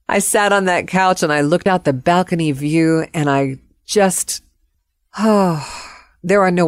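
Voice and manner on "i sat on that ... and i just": ordinary talk, nothing marked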